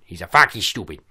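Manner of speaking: loud, put-on mangled diction; goofy impression with clipped-off word endings; mock dimwit voice. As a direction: squash the words in a silly put-on voice. Put-on voice repeating his own insult.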